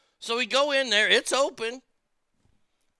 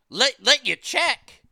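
higher, slow, disbelieving echo; louder, drawn-out mock astonishment; incredulous repeat; 5 semitones higher, 5 dB louder, slower than usual. Incredulous at the host.

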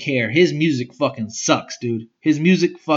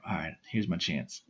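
muttered aside; 8 semitones lower, 14 dB quieter than usual. Retold or imitated. Muttered scheming to himself.

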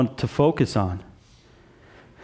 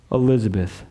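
ordinary talk

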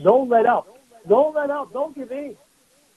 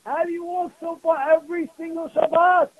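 higher, lightly voiced curious kid; child's question; 7 semitones higher than usual. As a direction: ask it like his ten-year-old. My ten-year-old was telling me.

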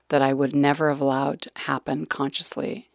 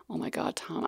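hushed distress; 3 semitones higher, 10 dB quieter than usual. Retold or imitated. Bawling in doctor's office.

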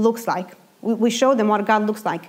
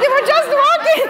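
high, louder squeal through laughter; laughing protest; 5 dB louder than usual. Retold or imitated. To God about kids walking home, high laughing squeal.

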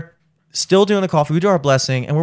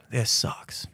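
soft, voiceless mock misery; low-level crying; 2 semitones lower, 13 dB quieter than usual. Shown as a demonstration. Showing a mild level of crying, miserable. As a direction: sigh it out, quietly miserable. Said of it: "there's different levels of crying".

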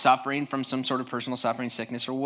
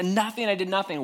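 higher-pitched mock denial; Job's defensive, high protest; protesting innocence; 5 semitones higher than usual. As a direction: plead innocence in a raised pitch. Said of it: "he's like".

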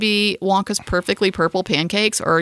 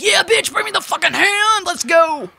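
high mock-hype teen shout; a hammed-up wild teen egging friends on; hyped teen self; 11 semitones higher than usual. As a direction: bark it like a reckless sixteen-year-old. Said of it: "I would've been like".

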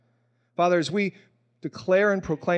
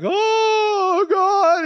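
much higher, louder, breaking into a cry; 12 semitones higher, 6 dB louder than usual. Retold or imitated. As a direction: let it crack into tears, overwhelmed. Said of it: "I'm a crier".

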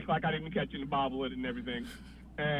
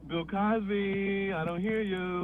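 dazed; 2 semitones higher, slower than usual. Retold or imitated. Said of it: "inner thought slow".

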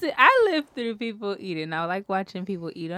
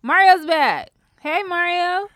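excited greeting; 10 semitones higher, 11 dB louder, slower than usual. Real, not acted.

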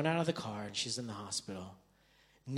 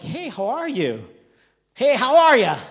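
loud, high, hearty hello; effusive American greeting; 11 semitones higher, 16 dB louder than usual. Acted. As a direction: greet them with hammed-up enthusiasm. Americans' over-friendly greeting, acted.

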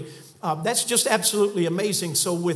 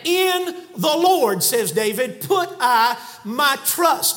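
high, slow, word by word; preacher drawing out the Psalm slowly; slow emphatic scripture; 5 semitones higher, 4 dB louder, much slower than usual. Acted. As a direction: dwell on each word of the verse. Repeating the verse.

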